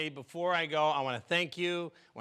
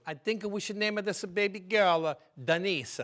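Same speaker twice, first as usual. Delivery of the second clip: put-on old Italian uncle accent; Italian uncle naming the baby; 3 semitones higher than usual. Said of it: "an Italian uncle straight from Italy".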